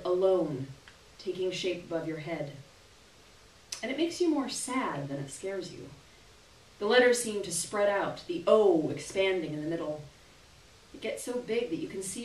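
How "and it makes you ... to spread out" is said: plain reading voice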